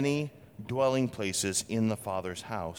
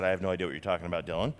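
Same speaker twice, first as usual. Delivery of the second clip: lower, very fast tossed-off version; a hint of a wife rolling her eyes; lightly voiced exasperated wife; wife's weary retort; 6 semitones lower, much faster than usual. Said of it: "Wouldn't be the first time she said".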